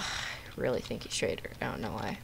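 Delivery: usual speaking voice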